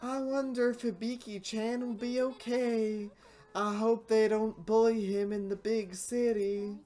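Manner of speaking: put-on worried older sister fussing at the dock; big sister voice.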